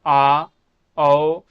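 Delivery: आ and औ held out loud and open; teacher calling out the vowel sounds; sound demo. Shown as a demonstration. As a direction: sound out आ, then औ, loudly. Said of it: "ए के उच्चारण होते हैं".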